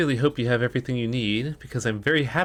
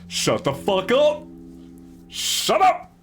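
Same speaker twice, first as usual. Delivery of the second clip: furious shout; 12 semitones higher, much slower than usual. Real, not acted. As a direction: scream them into silence. Yelling at the game characters.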